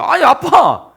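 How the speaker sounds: high, loud acted outcry; protesting son. Son objecting to his furious father, loud mock protest. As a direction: call out in protest to dad.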